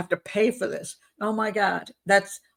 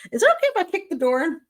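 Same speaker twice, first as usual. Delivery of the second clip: mock fiance pleading; 9 semitones higher, faster than usual. Mocking the fiance's request.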